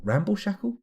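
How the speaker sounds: high, rising self-doubting question; high-pitched baffled echo; incredulous query. Real, not acted. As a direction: query your own slip in disbelief. Questions own slip of the tongue.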